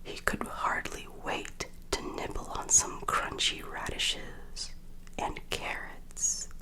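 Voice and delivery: ordinary delivery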